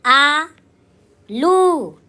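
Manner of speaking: आलू split into a long आ, a pause, then a long लू; very slow आलू, each syllable stretched for writing; dictated syllable by syllable. Shown as a demonstration. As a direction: dictate आलू in two drawn-out pieces. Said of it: "यहाँ लिख दो".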